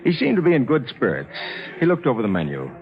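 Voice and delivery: measured, sober narrator recounting the tale; a lawyer calmly setting out the facts; story narration. A narrator's reading. Lawyer narrating his friend's story to listeners, measured narration.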